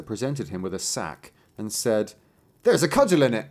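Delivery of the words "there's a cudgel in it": master's announcement. A narrator's reading. Master presents the sack.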